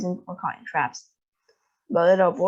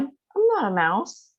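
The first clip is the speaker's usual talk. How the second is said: higher, slow and stubborn; a boy refusing the truth, slowly and higher; insistent denial; 7 semitones higher, slower than usual. Acted. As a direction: drag out each word in protest. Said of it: "he cried".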